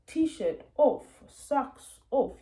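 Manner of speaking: put-on high, loud sing-out to a small child; narrating to child. Shown as a demonstration. Parent talking a child through undressing, narrating as they go. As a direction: call out each step brightly for a child. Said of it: "narrating as you go".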